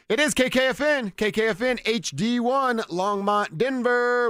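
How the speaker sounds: high, loud radio announcer voice; station ID read. Acted. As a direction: boom out the call letters.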